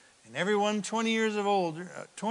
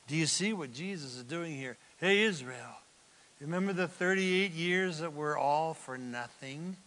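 ordinary talk

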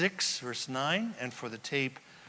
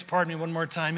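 ordinary talk

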